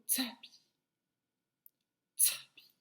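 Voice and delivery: "tapis" with a sharp, puffed t; exaggerated t opening "tapis"; emphasized initial T. Shown as a demonstration. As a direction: hit the t of "tapis" crisply. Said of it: "par quel son commence".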